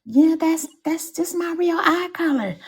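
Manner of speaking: high, mocking girlish voice imitating her; squeaky mock of a woman insisting it's real; hammed-up imitation of a woman's claim. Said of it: "talking about something".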